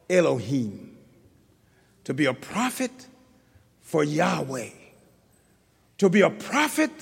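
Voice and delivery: softly intoning preacher; hushed preaching. Acted. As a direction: pull the room in close and quiet. Soft, hushed sermon stretch.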